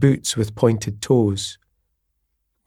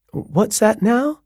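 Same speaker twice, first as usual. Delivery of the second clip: much higher, slow, puzzled landlady question; lilting landlady; 10 semitones higher, slower than usual. A narrator's reading. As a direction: draw it out high, a puzzled host. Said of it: "the way her voice went up at the end of each sentence".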